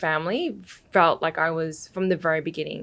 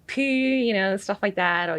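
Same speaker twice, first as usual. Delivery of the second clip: usual speaking voice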